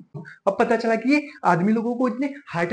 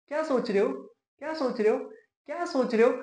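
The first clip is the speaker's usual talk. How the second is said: soft, higher-pitched, repeated needling; imitated girlfriend; 4 semitones higher, 6 dB quieter than usual. Boyfriend mimics girlfriend prodding him as he sits silent, soft high voice. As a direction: pester sweetly in a small, mimicked girlfriend voice.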